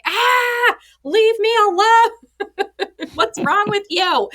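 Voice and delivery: hammed-up slow snarl through laughter; mock snapping. Mom imitates snapping at her seven-year-old, high and laughing. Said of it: "And I was like".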